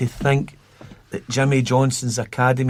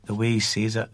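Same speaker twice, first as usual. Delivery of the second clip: ordinary delivery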